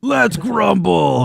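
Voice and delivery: high, slow, drawn-out shout; Grumblejack's battle cry. Acted. Grumblejack charges off down the way, long rallying shout. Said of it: "he starts running down the way".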